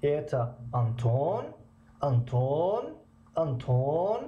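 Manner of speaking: "Антон" stretched, gliding up on the last syllable; question intonation. Shown as a demonstration. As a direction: draw out the end of "Антон" into a rising question. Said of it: "бештар талаффуз кунем".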